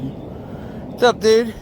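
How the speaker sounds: goofy hi to a frog; talking to the frog. Pretend. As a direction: chirp hello to a tiny frog. Greeting a little frog.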